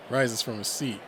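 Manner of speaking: plain reading voice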